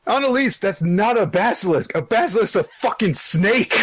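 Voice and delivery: high, indignant comic rant; worked-up nerd indignation; mock outrage. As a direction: correct them in exasperated high pitch. Mock outburst correcting Annalise.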